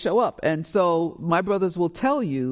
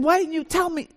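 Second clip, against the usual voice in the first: indignant shout; 11 semitones higher than usual. Retold or imitated. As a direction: demand it, shocked and betrayed. Sister confronting her brother.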